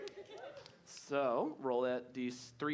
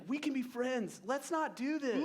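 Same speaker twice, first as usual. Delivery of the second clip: pleading reindeer voice; 6 semitones higher than usual. Acted. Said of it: "She's like".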